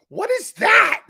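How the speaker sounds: high astonished yell; shocked shout. Retold or imitated. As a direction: blurt it out in disbelief. Shocked retold outburst.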